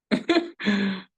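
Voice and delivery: short high-pitched laugh. Real, not acted. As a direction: let out a high little laugh. Laughs between remarks.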